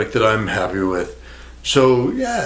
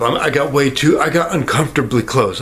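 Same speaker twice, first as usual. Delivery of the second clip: ordinary talk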